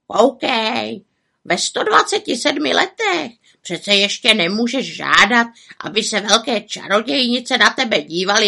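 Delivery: high raven voice, coaxing; consoling raven. A narrator's reading. Raven comforting the young witch, coaxing squawk. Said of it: "se pokoušel malou čarodějnici utěšit".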